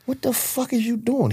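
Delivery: high-pitched incredulous question; retold astonishment. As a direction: act out being stunned, pitch way up. Retells stunned question to friend.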